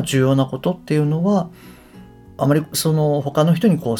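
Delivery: ordinary talk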